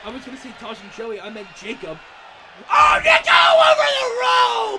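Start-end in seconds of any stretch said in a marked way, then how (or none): 2.7-4.8 piercing screamed call, high and loud; ecstatic scream. Real, not acted. Commentator to fans, screaming at the dive. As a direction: lose it as he flies over the ropes.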